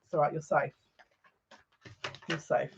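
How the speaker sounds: calm him down gently; soft reassurance to a nervous friend; reassuring. Pretend. Soothing a panicking friend.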